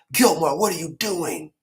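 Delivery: high, loud alarmed question; shocked comrades. Retold or imitated. Knights alarmed at Gilmore taking the treasure, high and loud. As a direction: call him out in alarm. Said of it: "And then everybody like".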